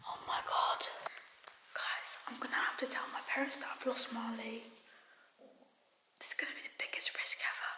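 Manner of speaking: frightened hushed whisper; scared whisper. Real, not acted. Sneaking inside to tell parents, scared whisper. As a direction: whisper so the parents don't wake. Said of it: "I'm scared".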